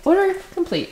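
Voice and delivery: high-pitched echo of a machine alert; imitating an announcement. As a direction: pipe it up like a scanner announcement.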